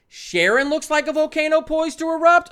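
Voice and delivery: can't believe what the game says; outraged disbelief. Real, not acted.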